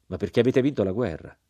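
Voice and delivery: plain reading voice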